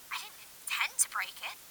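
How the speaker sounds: a barely caught whisper on tape; faint ghost on recording. Acted. The replayed recording of the ghost, faint whisper. Audio began to play.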